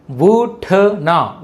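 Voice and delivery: very slow, drawn-out ఉట్నా; syllable by syllable. Shown as a demonstration. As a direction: sound out ఉట్నా for learners. Teacher sounds out new word.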